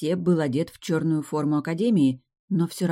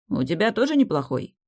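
ordinary delivery

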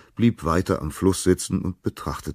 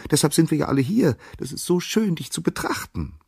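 very high, chattering bird voice; high-pitched adoring twitter; 13 semitones higher than usual. A narrator's reading. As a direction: chirp excitedly at the top of your range. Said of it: "zwitscherten die Vögel zurück".

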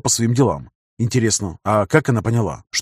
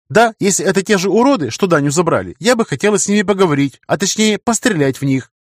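put-on lightly raised girl's voice; young woman voice; 7 semitones higher than usual. A narrator's reading. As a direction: voice her a bit higher. Narrator voices Света.

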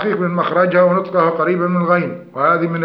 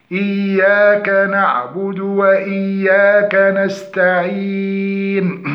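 slow, sustained tajweed chanting; Quran recitation; much slower than usual. Shown as a demonstration. Teacher repeats the opening verses from the start, slow recitation. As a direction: recite steadily, stretching the verse endings long. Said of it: "فسنعود من الأول ونكرر".

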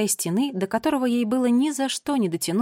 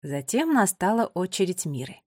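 plain reading voice

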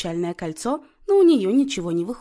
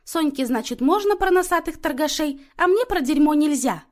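high, loud aggrieved complaint; huffy; 7 semitones higher, 5 dB louder than usual. A narrator's reading. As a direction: protest the unfairness in a huff. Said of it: "насупилась та".

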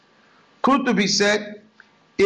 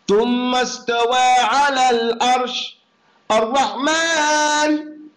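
Quran recitation; 3 semitones higher, much faster than usual. Acted.

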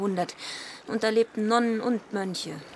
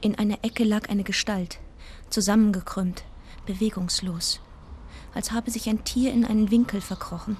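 hushed, even storytelling voice; narrating a trip remembered; story narration. A narrator's reading. First-person story narration.

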